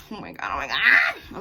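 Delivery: panic, rushing to get ready; panicked outburst. Real, not acted. Rushing with no pants on, she panics out loud.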